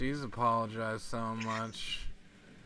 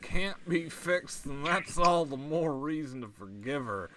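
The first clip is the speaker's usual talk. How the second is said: visual novel reading; 6 semitones higher than usual. Acted. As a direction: draw out the monologue in a raised voice. Reads visual novel aloud.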